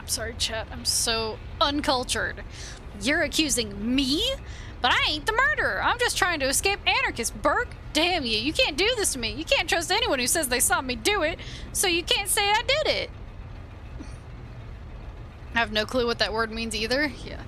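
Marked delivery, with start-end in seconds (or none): 3.0-13.1 high, agitated accused man; indignant protest. Acted. Accused suspect denies the murder to the investigator, indignant. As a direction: protest hotly in a raised pitch.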